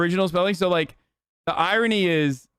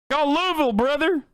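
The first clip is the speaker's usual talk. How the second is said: mock local dude; 10 semitones higher than usual.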